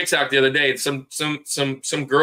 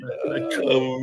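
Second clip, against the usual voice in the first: ordinary talk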